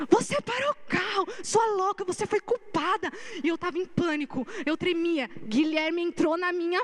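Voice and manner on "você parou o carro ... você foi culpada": high-pitched retold accusing yell; imitating an angry driver. Taxi driver shouting at her after hitting her car, mock furious. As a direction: throw the blame at her like the raging driver. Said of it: "ele veio pra cima de mim".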